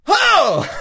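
excited high, loud exclamation; Joe Rogan. Retold or imitated. Imitating a commentator when something crazy happens, excited yell. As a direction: burst out like an amazed commentator. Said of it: "his head is excited ass voice".

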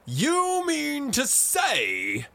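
drawn-out high, loud roar. A narrator's reading. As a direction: roar it in disbelief, stretching every word. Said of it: "roared Blazefeather".

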